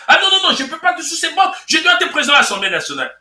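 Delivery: mock the minister refusing the job; indignant politician. Commentator voicing Kunzu refusing the vice-presidency, high indignant shout.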